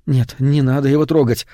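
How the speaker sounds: usual speaking voice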